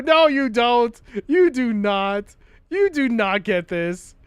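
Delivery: loud, high furious ranting; shaking with anger. Pretend. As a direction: yell at the puzzle in rage. Raging at the puzzle.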